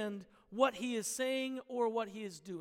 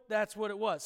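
ordinary delivery

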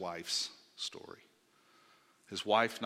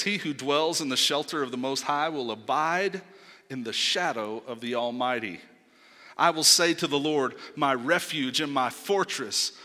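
loud, declaiming Bible reading; scripture reading; 4 semitones higher, 8 dB louder than usual. Acted. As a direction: proclaim the psalm to the room. Reads psalm aloud to congregation.

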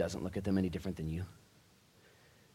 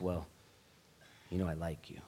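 whisper; 3 semitones lower, 8 dB quieter than usual. Acted. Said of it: "And he said".

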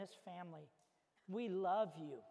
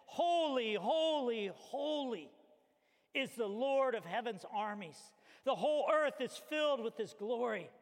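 angels crying out; 3 semitones higher, 5 dB louder than usual. Acted. Pastor voicing angels crying holy, loud and ringing. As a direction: cry it out like seraphim before the throne. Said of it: "they cried out saying this".

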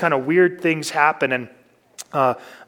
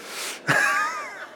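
short high laugh at himself; 5 dB quieter than usual. Real, not acted. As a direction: burst into a laugh. Laughs at own story.

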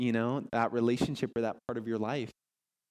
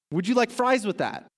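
chirpy cashier; 8 semitones higher, 4 dB louder than usual. Acted. Fast food worker quote.

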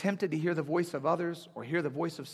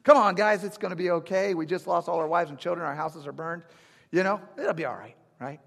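loud, falsely cheery pep talk; a mock-cheery rally that cracks into doubt; forced positive self-talk; 5 dB louder than usual. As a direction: bluff optimism over disaster. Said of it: "positive self-talk at that moment".

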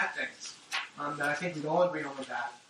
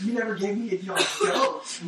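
higher and louder, half laughing at the grievance; mock whiny complaint; 5 semitones higher, 5 dB louder than usual. Preacher mocking the older brother's complaint with a laugh. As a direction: poke fun at the sulking brother's gripe.

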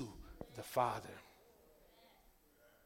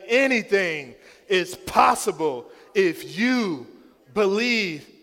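loud call and response; 5 semitones higher, 18 dB louder than usual. Shown as a demonstration. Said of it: "Look to your neighbor and say".

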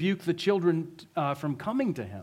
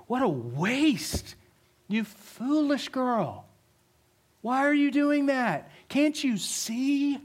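high, drawn-out indignant reproach; morally superior scolding; 8 semitones higher, much slower than usual. Acted. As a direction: act out a self-righteous telling-off in a raised pitch. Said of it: "didn't feel morally superior".

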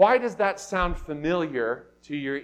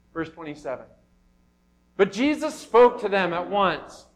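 ordinary talk

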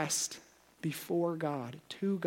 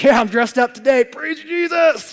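a hammed-up cheerful churchgoer, much louder and higher; mock churchgoer; 9 semitones higher, 14 dB louder than usual. Preacher mocking a dressed-up churchgoer, hammed-up loud cheer. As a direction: lay on the fake piety thick, stretching out "Praise Jesus". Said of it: "It's like".

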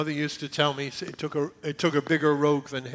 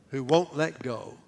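ordinary delivery

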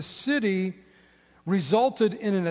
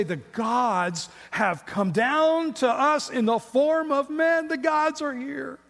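excited crowd; 6 semitones higher, slower than usual. Retold or imitated. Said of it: "they say".

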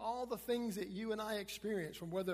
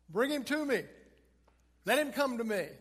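loud, higher, firm order; commanding call; 5 semitones higher, 9 dB louder than usual. Acted. Jesus telling disciples to bring the blind man, commanding call. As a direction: call it out over the crowd. Jesus calls over the crowd.

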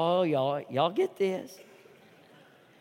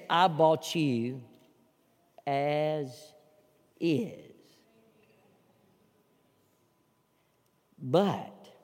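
much softer and slow; soft Jesus voice; 8 semitones lower, 7 dB quieter, much slower than usual. Acted. Jesus speaking to the congregation, soft and slow. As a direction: let it land gently. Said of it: "He says".

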